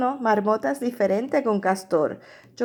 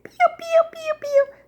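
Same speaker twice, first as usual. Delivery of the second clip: very high little bird cheeps; chirping. Shown as a demonstration. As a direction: imitate birds peeping in a tiny high voice. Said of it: "Pían es pío".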